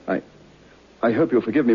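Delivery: normal talking voice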